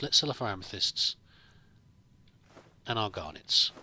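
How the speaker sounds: ordinary delivery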